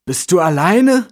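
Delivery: high, loud, drawn-out question; hesitant question. A narrator's reading. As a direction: call it over slowly and warily. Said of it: "fragte der Mann zögerlich".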